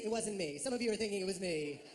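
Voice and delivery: normal talking voice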